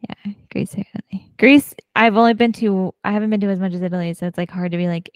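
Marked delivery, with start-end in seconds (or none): 0.1-1.3 whispered aside, a bit quicker. Real, not acted. Repeating her dream country to her cohost in a whisper. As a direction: breathe it out under your breath, a touch fast.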